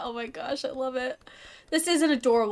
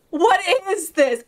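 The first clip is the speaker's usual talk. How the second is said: higher, wailed astonishment; escalating disbelief; 4 semitones higher, 3 dB louder than usual. Pretend. Streamer reeling after phasing through a fence, escalating disbelief. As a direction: wail the question in mock distress.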